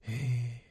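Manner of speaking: long, soft sigh; wistful sigh. A narrator's reading. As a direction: sigh softly with longing. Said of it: "Hayrullah iç geçirerek". (iç geçirerek)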